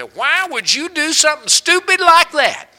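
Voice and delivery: loud, high exasperated yell; upset scolding. Retold or imitated. Mock-yelling at a relative who made a mistake, loud and high. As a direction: blow up at the family member. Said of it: "we get all upset and ... say".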